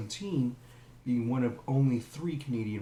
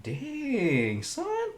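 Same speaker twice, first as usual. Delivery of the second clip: dang son. Real, not acted. Reaction to jailer.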